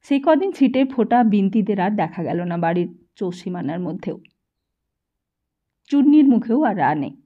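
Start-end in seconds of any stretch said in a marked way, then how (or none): none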